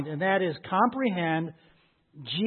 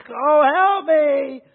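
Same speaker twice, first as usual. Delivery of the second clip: acted high, loud, drawn-out wail; crying out in pain; 9 semitones higher, 9 dB louder, much slower than usual. Preacher imitates a woman in labor, crying out in pain. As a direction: cry for help as if in labor. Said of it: "who's crying out in pain".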